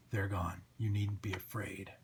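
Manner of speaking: low, soft hero voice; dark but calming antihero; reassuring Spawn. Acted. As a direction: reassure the victim low and quiet.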